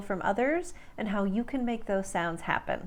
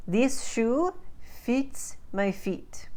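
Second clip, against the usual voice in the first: slow, higher Russian-accented demo; 4 semitones higher, much slower than usual. Shown as a demonstration. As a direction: say it slowly in Russian accent, fit sounding like feet. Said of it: "exactly the same in a Russian accent".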